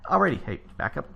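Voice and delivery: ordinary talk, nothing marked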